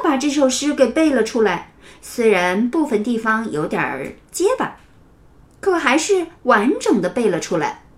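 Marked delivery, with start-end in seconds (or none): none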